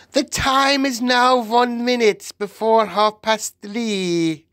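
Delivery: high, sustained proclamation; announcing witch. A narrator's reading. Witch calling the time to the room, announcing. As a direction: announce it on one held high pitch. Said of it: "announced the Grand High Witch".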